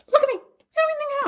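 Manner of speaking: chipmunk-high squeaky voice; sped-up high voice. Pretend. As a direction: squeak it like a fast-forwarded tape. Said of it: "the vocals are being sped up".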